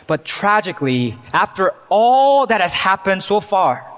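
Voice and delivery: ordinary talk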